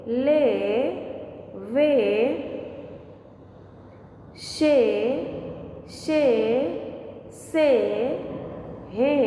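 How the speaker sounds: each syllable गे, घे, चे said alone with a pause after; teacher reading out the e-matra sounds for children; syllable drill. Shown as a demonstration. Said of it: "कैसे उच्चारण होता है".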